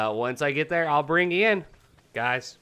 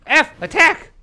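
high, loud panicked shouting; shouted commands; 13 semitones higher, 9 dB louder than usual. Real, not acted. Gamer ordering the dino to attack, shouting. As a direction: yell orders under attack.

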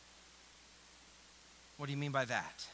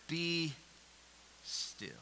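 hushed; 6 semitones lower, 12 dB quieter than usual. Acted. Favorite words quoted softly.

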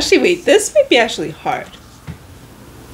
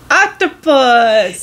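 slow, loud triumphant shout; excited shout; 5 dB louder, much slower than usual. Real, not acted. Guessing the drawing right.

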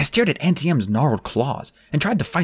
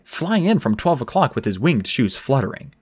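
plain reading voice

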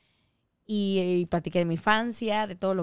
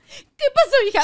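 mother crying; 13 semitones higher, 8 dB louder than usual. Retold or imitated. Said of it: "se pone a llorar conmigo".

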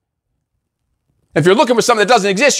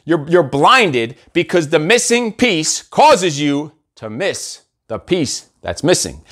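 slow repeat; 3 semitones lower, slower than usual. Shown as a demonstration. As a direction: lay the riddle out word by word, sinking away. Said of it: "I'll repeat that".

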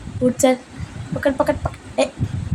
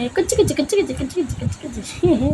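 higher, sing-song coo with rising ends; 3 semitones higher than usual. Real, not acted. Mother coos to her baby daughter in high baby talk.

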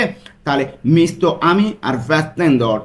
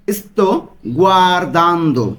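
slow, syllable by syllable গুয়ারদান্দো; slow pronunciation demo; slower than usual. Shown as a demonstration. Teacher drilling students on গুয়ারদান্দো, slow and careful. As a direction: stretch out গুয়ারদান্দো, gwar-DAN-do, for learners to copy. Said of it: "উচ্চারণগুলো কিন্তু আপনারা ভালো মতো খেয়াল করবেন".

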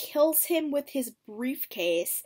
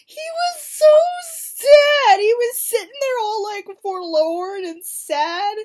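much higher, louder, aww-voiced sympathy; gushing pity; 12 semitones higher, 14 dB louder than usual. Real, not acted. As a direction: coo over him like a sad puppy. Gushing over sad character.

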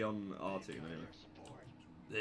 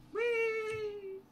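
high playful squeal; gleeful whee; 5 dB louder than usual. Real, not acted. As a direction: squeal with glee.

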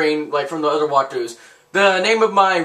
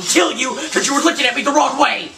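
higher, worked-up rant; mock angry threat; 7 semitones higher than usual. Gamer threatening someone who looked at him wrong, mock furious. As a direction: rise into a high, hammed-up threat.

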